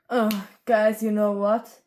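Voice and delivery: usual speaking voice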